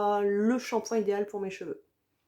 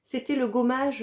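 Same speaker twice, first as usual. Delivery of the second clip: normal talking voice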